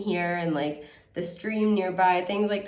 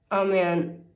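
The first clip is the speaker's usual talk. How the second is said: usual speaking voice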